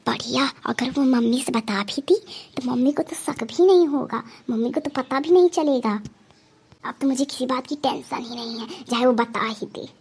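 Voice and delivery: secret whisper to herself; hushed scheming aside. Acted. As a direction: whisper it so mummy can't hear. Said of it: "क्या बेटा फुसफुसा रही हो?".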